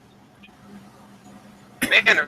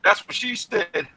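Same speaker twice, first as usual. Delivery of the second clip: joking; 10 semitones higher, 4 dB louder, slower than usual. Pretend. Joke after "keep my hand away.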